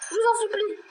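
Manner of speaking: desperate begging. Real, not acted. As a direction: beg for your life, laughing. Begging monster, shouting.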